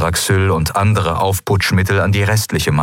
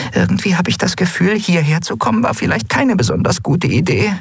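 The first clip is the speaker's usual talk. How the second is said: very high little voice; tiny whispery creature; 12 semitones higher than usual. A narrator's reading. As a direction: squeak it small and high, from hiding. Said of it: "wisperte Nelen in ihrem Versteck".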